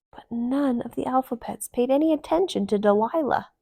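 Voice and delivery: plain reading voice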